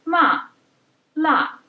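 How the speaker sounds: 'Ma' and 'la' dropping sharply from high; fourth tone. Shown as a demonstration. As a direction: snap 'ma' down hard like a command. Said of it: "associate it with an angry command".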